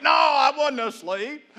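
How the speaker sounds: slow, sleepy denial; fake-awake mumble from someone just woken; groggy sleeper. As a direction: drag it out like you just woke up. Voicing a groggy sleeper denying.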